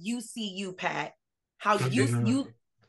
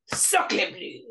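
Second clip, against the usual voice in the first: a bad French accent, breathed and pitched higher; airy mock French; 9 semitones higher than usual. Friends riffing on a bad French accent, airy mock French. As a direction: whisper it like a cartoon Frenchman. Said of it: "this bad French accent".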